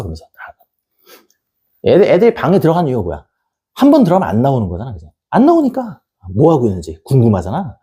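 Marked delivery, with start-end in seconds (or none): none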